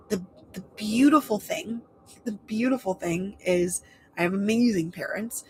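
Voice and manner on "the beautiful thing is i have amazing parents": ordinary talk, nothing marked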